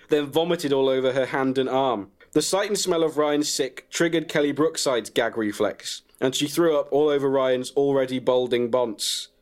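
plain reading voice

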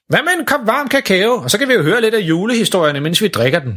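light father voice; dad's voice. Acted. Father proposes cocoa.

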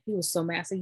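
usual speaking voice

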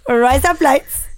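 put-on Indian accent. Shown as a demonstration. Showing co-host the better version, Indian accent. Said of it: "if you say it in an Indian accent".

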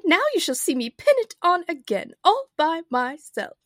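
high, loud and proud; triumphant cry. A narrator's reading. The Queen will pin her shawl herself, crying out. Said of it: "she cried".

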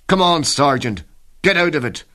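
high, loud battlefield yell; urgent shout. Acted. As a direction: shout him out of the trench. Comrades yelling in battle.

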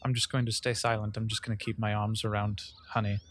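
low, soft subdued voice; hushed comfort. Acted. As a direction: say it low and soft, holding the griever. Player describes silent comforting embrace.

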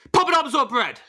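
high, sudden game-show shout; spring the question at the top of your pitch; mock-urgent high yell; shouted demand. Host springs the show's shouted question.

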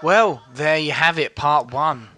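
put-on bright, slow children's TV presenter voice; host a kids' show, slow and upbeat; kids show host. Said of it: "why are you talking like a children's T V presenter".

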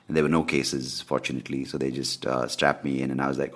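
ordinary talk

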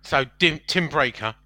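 ordinary delivery

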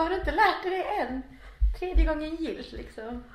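laughing at her friend who doesn't know lobad. Real, not acted. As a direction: tease him while cracking up.

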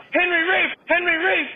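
very high, loud mock chant; insistent masseuse. Masseuse repeating herself while she works, high mock insistence. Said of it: "she's going".

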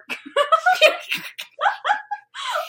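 high helpless cackle; squealing fit of laughter; cracking up. Real, not acted. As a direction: burst out laughing uncontrollably. Laughing at Dumbledore misreading.

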